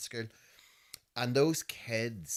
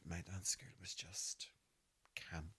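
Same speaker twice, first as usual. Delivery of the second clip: whispered, much softer; whispered punchline; 4 semitones lower, 13 dB quieter than usual. Real, not acted. Confiding to the host that dance school was camp, whispered. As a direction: drop to a conspiratorial whisper.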